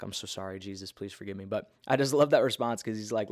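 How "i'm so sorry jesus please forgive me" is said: soft, quick mock-sorry aside; sheepish apology. Real, not acted. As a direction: murmur a half-joking apology. Jokey apology after calling God smart aleck.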